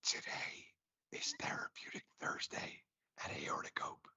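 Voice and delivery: soft breathy ASMR whisper. Shown as a demonstration. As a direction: whisper it close to the mic. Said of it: "the reason why I'm whispering".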